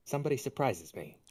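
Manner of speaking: normal talking voice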